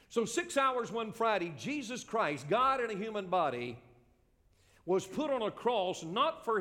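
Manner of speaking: high, emphatic pulpit voice, drawing out key words; full-force preaching. Real, not acted. Preacher proclaiming the cross to his congregation, impassioned.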